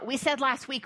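usual speaking voice

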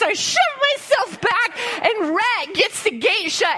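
usual speaking voice

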